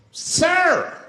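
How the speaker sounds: high, loud cry of outrage; freaked-out shout. Acted. Bus passenger spotting a cigarette, outraged shout. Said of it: "people freaked out".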